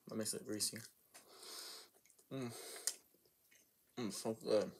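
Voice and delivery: chewing while talking; eating between words; mouth full. Real, not acted. Seen 1: holds food piece, brings it to mouth, mouth closed chewing.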